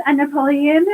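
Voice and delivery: ordinary delivery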